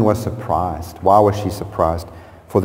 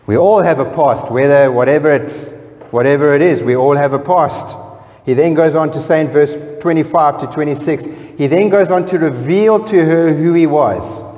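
higher, louder preaching; impassioned preaching; 6 semitones higher, 3 dB louder than usual. Acted. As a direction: drive it home to the congregation. Preaching at full force.

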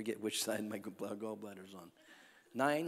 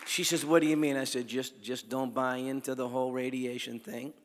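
loud, fervent preaching; full-force preaching; 9 dB louder than usual. Real, not acted.

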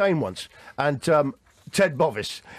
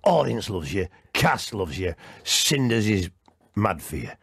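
much lower, coaxing veteran comic; imitating Paul Shane; 9 semitones lower, slower than usual. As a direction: sink the voice low and sweet-talk him. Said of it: "He goes".

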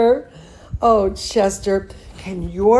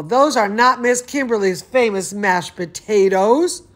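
plain reading voice

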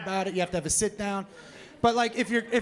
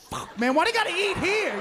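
higher-pitched exasperated gripe; griping waiter; 8 semitones higher, 4 dB louder than usual. Acted. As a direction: moan it like a fed-up waiter. Waiter gripes as customers arrive.

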